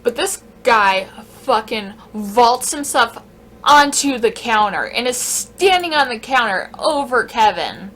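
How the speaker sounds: normal talking voice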